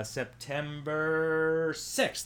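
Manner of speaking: very slow, pitch held up; drawn-out recall. Real, not acted. Host checking his show's start date, drawn out. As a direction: stretch each syllable while checking.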